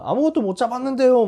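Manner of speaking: high, loud call back across the water. Retold or imitated. Disciples calling back to Jesus on the shore, loud and high. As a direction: shout it back from the boat.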